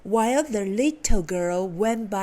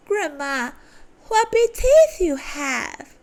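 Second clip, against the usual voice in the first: put-on much higher, louder frightened girl; girl crying out; 6 semitones higher, 3 dB louder than usual. A narrator's reading. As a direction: cry out in alarm.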